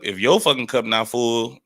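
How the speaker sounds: ordinary delivery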